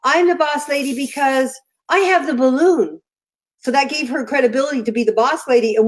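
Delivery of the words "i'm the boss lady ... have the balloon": higher-pitched imitation of a bossy little girl; retold child's proud proclamation, pitched up; bossy granddaughter. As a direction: declare it like a child with unbeatable logic. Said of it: "and she goes".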